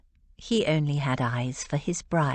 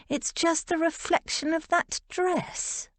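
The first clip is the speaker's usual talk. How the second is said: Rose, teasing; 11 semitones higher than usual. Acted.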